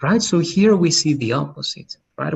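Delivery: ordinary talk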